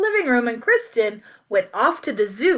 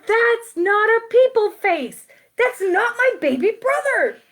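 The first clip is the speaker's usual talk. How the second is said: put-on a much higher little-girl voice, slowed; indignant child; 10 semitones higher than usual. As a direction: Kristen insists, squeaky and stubborn. Child protesting in the story.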